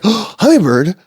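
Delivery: high gasped surprise; acted-out high, breath-caught amazement; startled wonder. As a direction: gasp and marvel at the sight. Acts out startled sight of bird.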